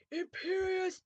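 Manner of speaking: high-pitched villain shout. A narrator's reading. Voldemort casting a spell, high shout. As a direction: cry it out like a spell. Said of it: "but then Voldemort shouted".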